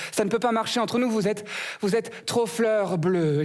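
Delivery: high, slow, mock-dramatic brush-off; rose's voice. The rose dumps the comic in a high, slow voice. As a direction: let him down like a fussy flower.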